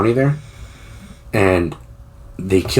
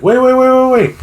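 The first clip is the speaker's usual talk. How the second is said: urgent wait; 6 dB louder than usual. Retold or imitated. Retold guy stopping the story.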